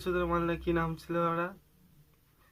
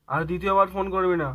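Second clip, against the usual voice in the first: loud, firm final order; angry warning; 6 dB louder than usual. Acted. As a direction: lay down the law before hanging up. Warning ex not to call back.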